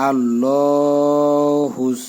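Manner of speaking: আল্লাহুস very slow with a thick L; chanted, full-mouthed আল্লাহুস; heavy drawn Allahus. Shown as a demonstration. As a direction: stretch আল্লাহুস one alif long and heavy. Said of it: "এখানে মোটা করে পড়তে হবে".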